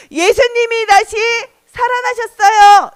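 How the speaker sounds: high, loud announcement; acted jubilant proclamation; proclaiming. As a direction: shout the good news out. Proclaims Mary's news of resurrection.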